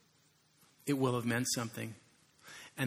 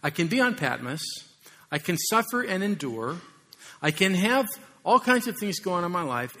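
John pleading; 5 semitones higher, 6 dB louder than usual. Retold or imitated. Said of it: "he says".

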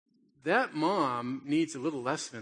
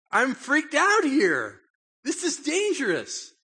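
much higher and louder, slow terrified whine; freaked-out kid; 11 semitones higher, 8 dB louder, slower than usual. Retold or imitated. Boy telling his dad he's scared, high and frightened. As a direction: wail it like a child sure he's in danger. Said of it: "I'm like".

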